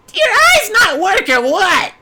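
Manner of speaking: high, loud, scratchy little creature snarl; Grim snarling. A narrator's reading. Grim snarls at Yuya from below, high and loud. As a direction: snap it up from below like an angry critter. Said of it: "the voice snarled from below".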